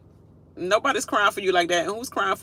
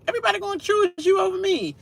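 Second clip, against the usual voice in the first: mock whiny houseguest; 13 semitones higher, faster than usual. Mocking another player.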